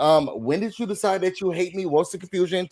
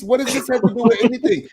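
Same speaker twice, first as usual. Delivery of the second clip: higher-pitched retold retort; acted arguing; 7 semitones higher than usual. Host voices Wendy's comeback to Kay, animated retort. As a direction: fire back in a raised voice. Acting out the quarrel.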